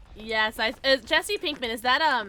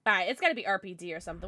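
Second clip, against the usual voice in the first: ordinary delivery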